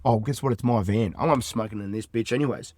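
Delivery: ordinary talk, nothing marked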